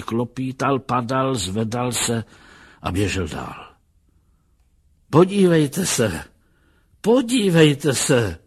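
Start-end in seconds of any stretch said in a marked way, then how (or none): 5.1-8.4 high, loud, drawn-out calling; excited shout. A narrator's reading. Lustig calls the others to look down the street, excited shouting.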